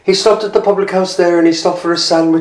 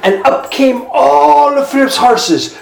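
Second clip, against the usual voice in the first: excited reveal; 7 semitones higher, 3 dB louder than usual. A narrator's reading. Climax narration.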